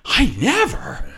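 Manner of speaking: high, drawn-out scandalized huff; theatrical pearl-clutching indignation; mock offense. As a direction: play it hammed-up and affronted, slowly. Acted offended reaction, then laughs.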